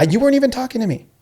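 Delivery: high, marvelling emphasis; lightly imitated astonishment; amazed. Coach repeating the student's words, high and amazed.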